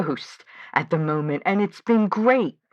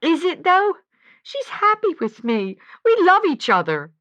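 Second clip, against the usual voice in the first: high, louder, defensive and close to tears; upset protest; 9 semitones higher, 5 dB louder than usual. Acted. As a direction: push back, clinging to her love. Defending her relationship, upset.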